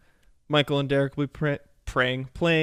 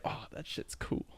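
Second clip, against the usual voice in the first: whispered; 15 dB quieter than usual. Retold or imitated. Retold reaction, whispered.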